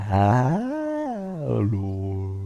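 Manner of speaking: drawn-out synthetic robot hello; old speech synthesizer. Shown as a demonstration. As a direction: stretch it like a voice changer. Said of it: "was ich noch kann, ist zum Beispiel das hier".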